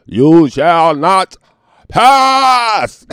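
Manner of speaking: high, loud, slow booming wizard command; Gandalf. Pretend. Channeling Gandalf, booming slow wizard shout. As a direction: thunder it like a wizard blocking the bridge. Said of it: "like Gandalf".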